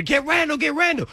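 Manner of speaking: high urgent call to the trainer; retold yell. Yelling to the trainer as Randall wobbles off, urgent shout. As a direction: shout for help across the field. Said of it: "yell to the trainer".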